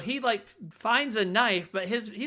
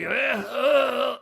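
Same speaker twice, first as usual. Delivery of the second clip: zombie groan; 5 semitones higher, 4 dB louder than usual. Retold or imitated. Dying man groaning up.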